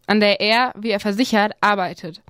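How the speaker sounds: ordinary delivery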